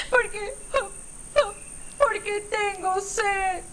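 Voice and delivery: high, slow, teary little voice; sobbing little elephant calf; crying baby elephant. Acted. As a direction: whimper it like a thirsty baby elephant.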